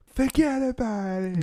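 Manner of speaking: high, drawn-out mock yell; hammed-up American slanging match, stretched slowly; arguing American. Americans arguing in a restaurant, high mock-argumentative voice. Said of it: "they can argue in a restaurant".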